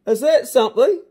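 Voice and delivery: loud, high burst of amazement; big impressed shout; amazed. Real, not acted. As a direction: exclaim it in sheer delight. Amazed reaction to speed recital.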